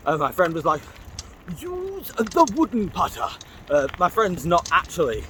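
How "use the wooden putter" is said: slow, high coaxing voice from his head; a high, drawn-out voice that tempts him into golf; imagined coaxing voice. Retold or imitated. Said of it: "it doesn't sound like that".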